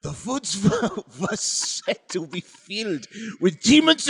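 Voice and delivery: theatrical mock German-accented storytelling; hammy German accent, swinging from hushed to booming. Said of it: "you're not doing the accent".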